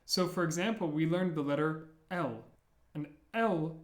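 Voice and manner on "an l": ordinary talk, nothing marked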